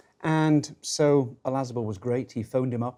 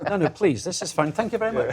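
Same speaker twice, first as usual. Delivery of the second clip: laughing; 7 semitones higher, 5 dB louder than usual. Real, not acted. Laughing refusal of coffee.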